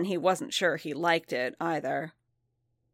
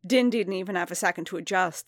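plain reading voice

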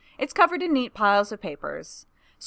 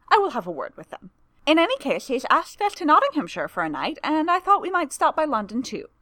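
higher, lively and gossipy; chattering young woman voice; 6 semitones higher, 3 dB louder than usual. A narrator's reading. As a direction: rattle on brightly. Tamara chattering on.

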